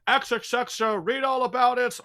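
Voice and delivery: high-pitched Cockney newsboy call; newsie headline cry. Shown as a demonstration. Showing players the game as a newsie, high headline cry. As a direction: hawk the paper like a little newsie. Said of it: "I'll be a little newsy in a hat".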